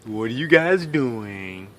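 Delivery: slow, low, unbothered question; calm bystander. Retold or imitated. Passing man asking the trapped kids, slow and calm. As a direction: ask it lazily like nothing's wrong.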